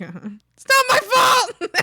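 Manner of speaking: high, loud laughing yell; mock defiant shout. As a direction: shout it back like a cheeky kid. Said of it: "I'm like".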